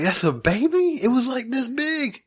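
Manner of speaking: high-pitched disbelief, stretching out at the end; incredulous. Retold or imitated. Storyteller reacting to hearing it's just a baby, incredulous. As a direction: squeak it in astonishment. Retold disbelief at possum's size.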